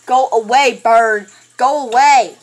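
loud, insistent shout; loud shooing yell. Real, not acted. Player ordering the bird away, loud yell. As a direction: shout to drive it off. Yelling at attacking bird in game.

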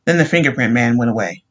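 plain reading voice

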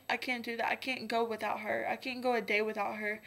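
ordinary talk

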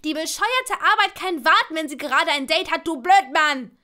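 loud, high, angry yell; drowning her out. A narrator's reading. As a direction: shout him down furiously. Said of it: "Doch Ginnys Stimme übertönte Hermine".

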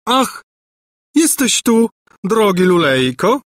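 high, gushing greeting; excited exclamation. A narrator's reading. Governess greeting her dear prince, excited exclamation. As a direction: cry out in a high, put-on lady's voice. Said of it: "wykrzyknęła Gburja Furia".

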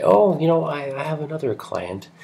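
high, airy acted-out chat; chatty self-quote. Acts out own chatty remark.

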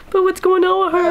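high, mock-crying worried voice; imitated tearful distress; retold tearful panic. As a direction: wail it high like a frightened mother. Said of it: "I start crying".

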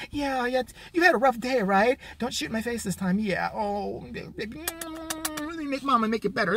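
put-on soft, high baby-talk mothering; mock mothering host. Host babying the boy, soft and high. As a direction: soothe him like a mama.

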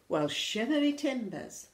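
lightly acted pirate surprise; pirate astonishment. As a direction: exclaim in mock amazement. Flynn's astonished pirate exclamation.

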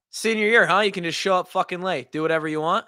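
sarcastic coach chirping. Retold or imitated. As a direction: needle the late kid like an annoyed coach. Coach chirping a late player.

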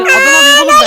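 high, loud furious threat; angry shout. Real, not acted. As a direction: yell in rage at friends. Trapped, threatening friends. (öfkeyle bağırarak)

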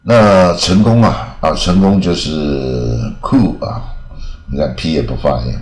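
normal talking voice

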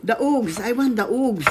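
Oogs said oogs with long oo; Oogs mispronounced. Real, not acted. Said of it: "What did you call it?".

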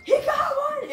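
high, loud cry of delight; excited. Retold or imitated. Sister at the truck announcing the kill, high excited shout. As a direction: shout the news to the whole family. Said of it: "comes up on the back of the truck and is like".

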